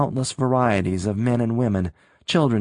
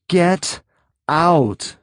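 shout; 7 semitones higher, 5 dB louder than usual. A narrator's reading. Rick shouts at Sylvia.

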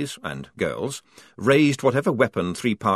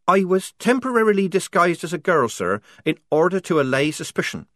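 put-on higher-pitched young soldier reporting; Polly reporting to officer; 8 semitones higher, 3 dB louder than usual. Polly explaining herself to her officer, plunging on.

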